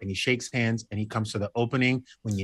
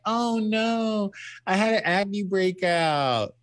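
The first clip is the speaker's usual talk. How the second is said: mock friend's whine; 8 semitones higher, much slower than usual. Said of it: "She was like".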